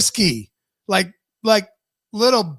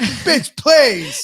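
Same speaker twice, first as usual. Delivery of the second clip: mock sass; 6 semitones higher than usual. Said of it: "he says".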